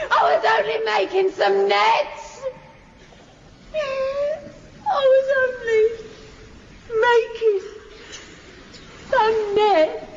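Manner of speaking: crying, slow, broken-up pleading; sobbing plea. Acted. Wife sobbing an excuse to her departed husband. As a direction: beg through tears.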